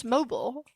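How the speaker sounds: "mobile" as MO-bull; MO-bull pronunciation. Shown as a demonstration. Streamer restates their verdict, "mobile" as MO-bull. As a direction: say "mobile" to rhyme with noble. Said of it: "I say mobile".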